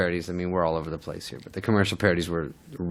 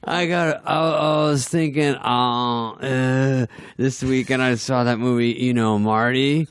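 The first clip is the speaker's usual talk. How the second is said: mock-nervous stammering pitch, raised voice; Chris Farley; 5 semitones higher, slower than usual. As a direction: stall and fumble like a panicked pitcher. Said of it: "he's, like, having a heart attack".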